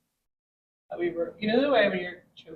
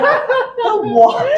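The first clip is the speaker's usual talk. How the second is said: high, loud laughing "The what?"; laughing disbelief; 11 dB louder than usual. Retold or imitated. Retold confused laughing reply.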